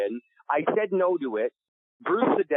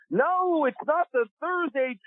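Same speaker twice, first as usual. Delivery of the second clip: very high, slow, sing-song impression of a TV producer; Brian's voice; 12 semitones higher, slower than usual. Producer pitching his show to Kevin on the phone, very high imitated voice. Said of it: "And he speaks like this".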